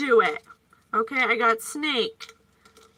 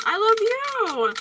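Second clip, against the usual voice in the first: tender; 9 semitones higher, 4 dB louder than usual. Real, not acted. Tender sign-off to viewers.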